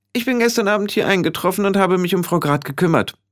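hoch und schnell, sachliche Hexe; zügig Bericht erstatten; resolute Hexe. Acted. (resolute Hexenstimme)

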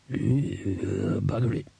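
put-on muttering old beggar; drawn-out groan into a grumbled curse; Foul Old Ron. A narrator's reading.

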